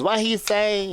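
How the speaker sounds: put-on high, puzzled old lady; a hint of a confused old woman. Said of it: "the lady next to her goes".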